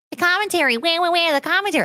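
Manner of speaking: high-pitched mock whining; mocking whine. Streamer mimicking a commenter upset about commentary, mocking whine. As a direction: imitate a complainer in a high crybaby voice.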